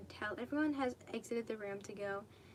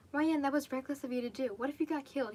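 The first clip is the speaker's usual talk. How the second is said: louder, alarmed fatherly scolding; worried scolding; 3 semitones higher, 6 dB louder than usual. Acted. A dad scolding his kid after a reckless stunt, loud and worried. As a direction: scold the child for nearly dying.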